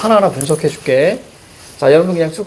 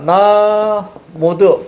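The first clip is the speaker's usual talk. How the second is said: 나 held long and higher, then 모두; syllable-by-syllable demo; 4 semitones higher, much slower than usual. Shown as a demonstration. Teacher breaks the word down for students, drawn-out demo of 나, 모두. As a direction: stretch 나, then sound out 모두. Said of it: "그랬을 때".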